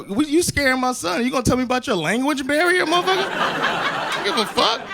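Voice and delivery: high, worked-up challenge; mock indignant confrontation. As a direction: act out an outraged dad not having it. Acted confrontation with crackhead.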